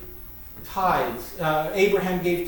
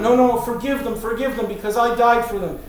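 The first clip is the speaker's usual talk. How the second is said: higher, louder hammed-up pleading; mock begging; 4 semitones higher, 4 dB louder than usual. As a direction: plead urgently, as a caricature of an advocate. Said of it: "begging God all the time".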